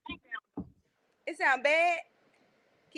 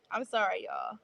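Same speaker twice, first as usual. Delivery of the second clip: ordinary talk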